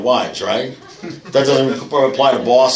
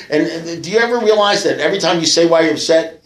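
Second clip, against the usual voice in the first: ordinary delivery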